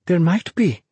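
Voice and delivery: high, eager reply; eager rising hope; full of excitement. A narrator's reading. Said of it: "full of excitement".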